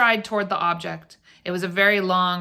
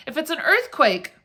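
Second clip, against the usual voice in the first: high-pitched near-hysterical retort; alarmed cry; 8 semitones higher than usual. A narrator's reading. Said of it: "she cried".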